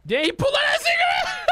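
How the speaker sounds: high-pitched, disbelieving yell; incredulous shout. Real, not acted. Incredulous outburst at friend's stunt.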